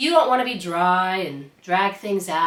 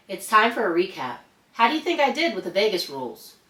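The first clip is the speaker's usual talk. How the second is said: usual speaking voice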